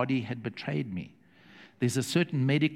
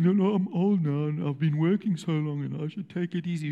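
mock tired elder; 3 semitones higher than usual. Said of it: "but you're sitting".

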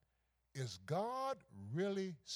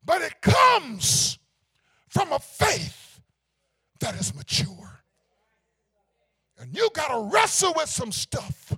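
very loud, slow, high pulpit cry; stretched sermon shout; preaching at full force; 10 semitones higher, 18 dB louder, slower than usual. Real, not acted. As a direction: let it ring out, half sung. Preacher erupts in climax.

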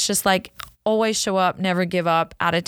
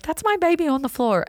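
put-on much higher, a mother's alarm at her fallen child; panicked mom; 10 semitones higher than usual. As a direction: cry it out high as you rush to him. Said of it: "I'm like".

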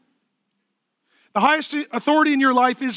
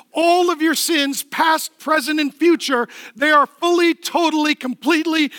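building preaching; 3 semitones higher than usual. Real, not acted. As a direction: build the promise higher line by line.

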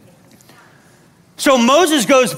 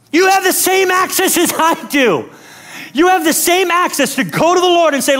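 high, insistent exhortation; emphatic preaching; 6 semitones higher than usual. Real, not acted. Preacher telling the church they have access, insistent. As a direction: drive it home to the congregation.

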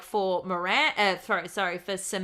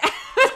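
burst of laughter; 12 dB louder than usual. Real, not acted. Laughs at own joke.

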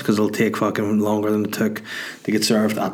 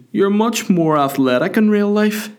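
high, put-on punter voice; drunk guy; 10 semitones higher than usual. Drunk fella chirping at the comedian, high mimicry. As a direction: imitate a drunk fan's backhanded praise, pitched right up. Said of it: "just some drunk guy".

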